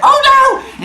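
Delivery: high, loud cartoon alarm; panicked rabbit. Rabbit sees turtle nearly winning, panicked cry. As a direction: yelp it in mock panic.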